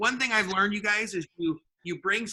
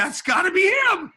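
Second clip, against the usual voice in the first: excited realization; 11 semitones higher, 6 dB louder than usual. Retold or imitated. Spotting the boss through window.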